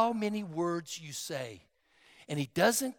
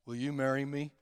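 low, soft, listless ask; a dull, lower, quieter marriage question; flat mumbled proposal; 5 semitones lower, 8 dB quieter, faster than usual. Shown as a demonstration. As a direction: propose with no feeling at all. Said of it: "your tone of voice makes a difference".

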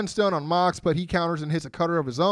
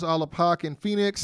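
usual speaking voice

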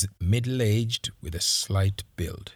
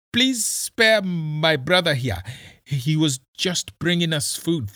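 high, louder trembling plea; shaking voice; 8 semitones higher, 6 dB louder than usual. Acted. Roba begging Kisii to spare his brother, voice shaking. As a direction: beg for your brother's life, voice shaking. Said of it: "his voice shaking".

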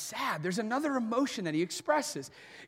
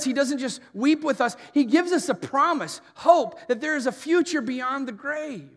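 louder, driving sermon voice; preaching at full force; 3 semitones higher, 10 dB louder than usual. Acted. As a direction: preach it out to the congregation. Sermon climax.

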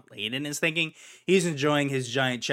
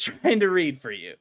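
usual speaking voice

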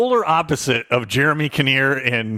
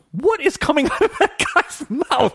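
high, laughing what is coming out; amused shock breaking into a laugh; laughing in stunned disbelief; 11 semitones higher than usual. Retold or imitated. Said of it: "I'm like".

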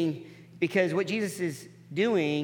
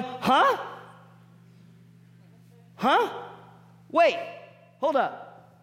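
higher, startled huh, huh; religious onlookers hearing Jesus forgive sins, startled and higher; baffled; 7 semitones higher, much slower than usual. Retold or imitated. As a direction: do the scandalized double take. Religious people react in disbelief.

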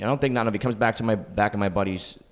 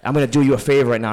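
mock threat; 4 semitones higher, 7 dB louder, faster than usual. Tom threatening to blacklist.

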